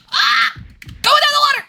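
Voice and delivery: high frustrated yell. Real, not acted. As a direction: shout it at the screen. Yelling at game.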